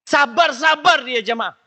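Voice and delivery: high, loud, slow exclamation; emphatic exclaim. Real, not acted. Preacher marvels to the congregation at patient wives, loud and slow. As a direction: proclaim it slowly to the crowd.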